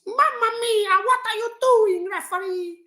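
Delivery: high, mock Italian accent complaining to the ref; Italian footballer impression. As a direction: ham up an Italian keeper moaning at the referee. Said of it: "He's Italian, yeah, with all these".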